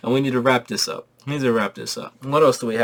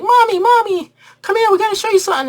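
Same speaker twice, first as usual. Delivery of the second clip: put-on squeaky high little kids calling Mommy; kid voices; 7 dB louder than usual. Kids begging their mom to come see. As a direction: chirp it up high like excited little boys.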